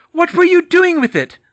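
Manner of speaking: high, very loud witch; shrieked interrogation. Acted. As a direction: shriek the question. Bellatrix interrogating.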